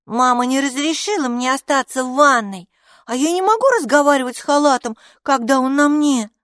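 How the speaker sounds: high, loud rush of grievance; indignant complaint. A narrator's reading. Майки complains to Капо about the robe, high indignant voice. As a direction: protest hotly like a cross child.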